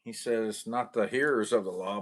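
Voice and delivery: usual speaking voice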